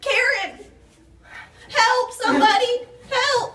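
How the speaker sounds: high, loud panicked yells with a laugh breaking through; panicked screaming. Acted. Wife whose water broke screams to Karen in hammed-up panic.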